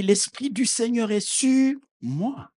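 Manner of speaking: ordinary delivery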